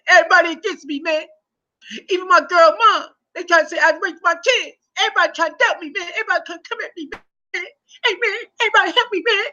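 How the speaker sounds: high-pitched hammed-up crying voice; mock sob story. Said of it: "my man told a, a, a, a sad story".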